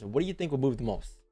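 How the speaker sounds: ordinary delivery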